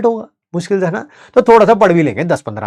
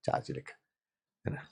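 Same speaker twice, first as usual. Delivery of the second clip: unvoiced whisper of charge ही लिखा है; hushed aside; 21 dB quieter, faster than usual. Real, not acted. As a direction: breathe it quietly to himself. Repeated point as aside.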